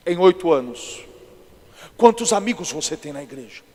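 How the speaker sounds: acted solemn questioning; different put-on voice. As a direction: put on a weighty pastor voice, projected firmly. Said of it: "fiz outra voz diferente".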